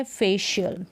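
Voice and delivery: "फेशियल" with sh mid-word; correct sh pronunciation. Shown as a demonstration. Teacher introduces the word facial, correct sh pronunciation. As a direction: say facial as "फेशियल", fe-shi-yal.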